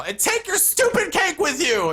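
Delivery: high, loud mock shriek; hammed-up screamed parting shot; girlfriend's yell. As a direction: yell after someone as the furious ex. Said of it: "I hear her yell".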